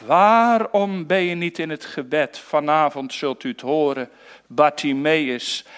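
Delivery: normal talking voice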